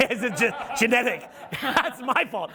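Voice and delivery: talking through a laugh. Real, not acted. Speaker jokes to audience about his son's genes, laughing. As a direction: laugh while joking at your own expense.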